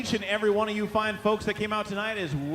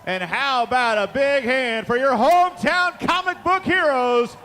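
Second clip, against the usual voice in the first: loud build, then a long high roar; grand entrance announcement; 7 semitones higher, 9 dB louder, much slower than usual. Real, not acted. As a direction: hype the crowd into a big hand. Introducing the hometown team.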